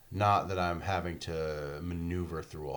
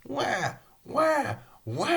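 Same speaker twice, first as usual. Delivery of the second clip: high mock baby wail of wah; mock baby crying; much slower than usual. Mimicking BB the baby crying during the fall. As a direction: wail it like a crying infant, much higher. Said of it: "but it's like".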